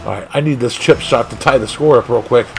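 usual speaking voice

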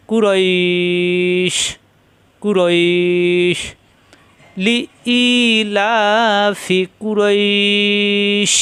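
slow drawn-out tajweed chant of লি ইলাফি কুরইশ with the long vowels stretched; Quranic recitation. Shown as a demonstration. Teacher reciting to students, slow drawn tajweed. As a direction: chant কুরইশ slowly, holding the ই long before the stop. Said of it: "দুই আলিফ টেনে শিনে এসে".

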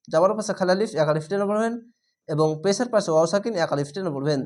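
normal talking voice

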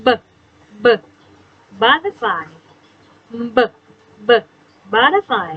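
b b sounded before butterfly; teacher sounding out the b sound; phonics sound. Shown as a demonstration. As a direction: bounce the b sound, then say butterfly. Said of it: "ข้อที่เจ็ดค่ะ".

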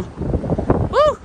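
high breathy whoop in the wind; windblown whoop. Real, not acted. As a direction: let out a high gust of a whoop. Very breezy up here.

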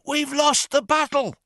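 put-on high, loud panicked boy's cry; a young boy shouting bad news; frantic boy calling out. A narrator's reading. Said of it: "he cried".

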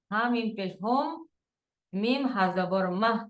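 ordinary talk

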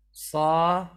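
صا said loud with a different mouth shape; a second variant of صا, loud; second demo take of صا; sad sound, take two. Shown as a demonstration.

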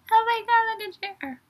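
high squeal after a laugh; giggly amazement. Real, not acted. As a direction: squeal it high, fading away. Giggling after mistaking one member.

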